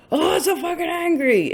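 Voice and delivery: imitating someone raging; mock anger. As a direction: play an over-the-top tantrum. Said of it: "even like".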